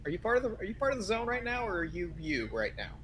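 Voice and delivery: high, soft, hurried question; wary questioning. Acted. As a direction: ask quickly and softly, unsure who is really there. Character questions whether friend is real.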